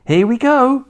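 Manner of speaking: high, loud excited call; a boy's loud, high call; crying out. A narrator's reading. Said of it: "George cried out".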